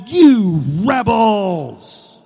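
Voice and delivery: loud, very drawn-out mock outburst; acted fed-up yell, slow and heavy; frustrated. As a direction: stretch each word in exasperation. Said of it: "he got a little frustrated".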